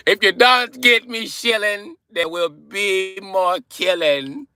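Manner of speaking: high, slow, menacing Irish imp; hammed-up villain with an Irish accent; Leprechaun. Shown as a demonstration. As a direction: threaten in a drawn-out mock Irish rhyme. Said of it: "Leprechaun was my shit".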